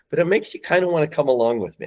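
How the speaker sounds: normal talking voice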